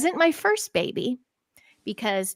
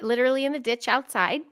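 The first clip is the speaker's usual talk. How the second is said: ordinary talk, nothing marked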